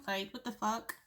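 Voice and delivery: hiccup mid-phrase; catching a hiccup on the words. Real, not acted. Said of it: "Kinda hiccuped?".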